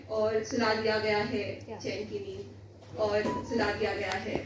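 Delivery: low, soft, fading close; hushed verse ending. Acted. Poet closing on a society put to sleep, hushed and low. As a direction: let the last line sink away quietly. Poem ends on being lulled asleep.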